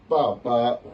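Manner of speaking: papa in level tones; first tone. Shown as a demonstration. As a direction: hold each "pa" of "papa" loud and steady. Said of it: "je n'ai pas dit".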